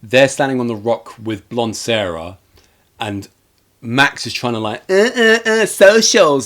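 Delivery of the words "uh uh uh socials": high, drawn-out imitation of fussing; hammed-up high whimpering; mock whiny fussing. Max trying on the rock, mock whiny fussing.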